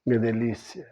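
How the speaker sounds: groggy husband greeting his wife at dawn; sleepy morning greeting. Acted. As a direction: mumble a drowsy good morning to your wife. Acting out waking his wife.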